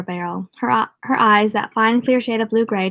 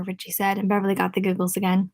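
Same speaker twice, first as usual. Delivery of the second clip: ordinary delivery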